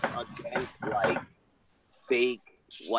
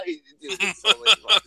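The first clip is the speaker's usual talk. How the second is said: excited; 10 semitones higher, 10 dB louder than usual. Real, not acted. Gleeful about trolling.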